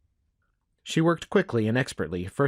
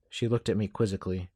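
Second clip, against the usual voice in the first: usual speaking voice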